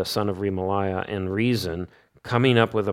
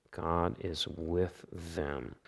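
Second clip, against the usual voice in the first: softer and slow, letting the line settle; soft echo; 4 semitones lower, 12 dB quieter, slower than usual. Acted. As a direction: repeat it hushed, almost to yourself. Repeated line, echoed softly.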